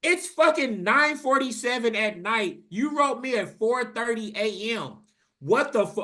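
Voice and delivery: venting at the forgetful brother; angry disbelief. Retold or imitated. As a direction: let the outrage boil over.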